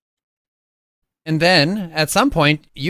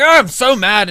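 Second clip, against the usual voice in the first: louder, higher mock rant; mock angry yell; 5 semitones higher, 6 dB louder than usual. Father retelling his frustration, mock angry yell. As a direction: act out grumbling about the bike. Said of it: "I'm yelling, and I'm like".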